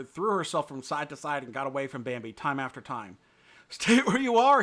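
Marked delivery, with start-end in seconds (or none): 3.7-4.6 loud breathless call; gasped shout. A narrator's reading. Bambi calling after Feline, gasping and loud. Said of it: "he gasped".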